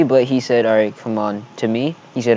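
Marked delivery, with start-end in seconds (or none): none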